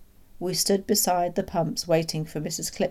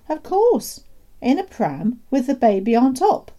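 high, loud, emphatic answer; gleeful explanation; 8 semitones higher, 8 dB louder than usual. Acted. Father revealing the pram trick to the doctor, gleeful. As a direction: explain as if delightfully obvious.

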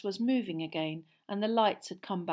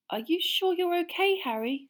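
higher, worried question; anxious; 11 semitones higher, 4 dB louder than usual. A narrator's reading. Hermione checks on Harry, a touch of anxious concern. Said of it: "watching Harry anxiously".

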